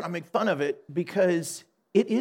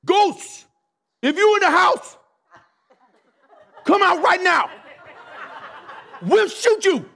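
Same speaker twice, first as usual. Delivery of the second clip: high, loud police commands in separated bursts; shouting at ghosts; 12 semitones higher, 6 dB louder than usual. Retold or imitated. Scared armed officers ordering ghosts out, mock shouting. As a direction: call out warnings into an empty house, hammed up. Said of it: "saying things out loud like this to the ghosts".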